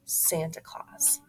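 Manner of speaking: breathy hushed reveal; dramatic whisper. Acted. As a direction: drop to a whisper for the big conclusion. Dramatic conclusion, unvoiced.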